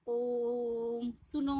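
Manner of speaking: ओम and सुनो with very long vowels; drawn-out distant call. Shown as a demonstration. Teacher showing a call from afar, drawn-out vowels. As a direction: stretch each word as if calling someone far off. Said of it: "जिसको हम दूर से".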